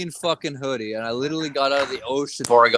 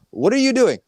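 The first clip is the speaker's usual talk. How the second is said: higher, louder indignant question; indignant retort, pitched up; exasperated challenge; 9 semitones higher, 5 dB louder than usual. Retold or imitated. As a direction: throw the question back at a critic. Challenging his online critics in retort.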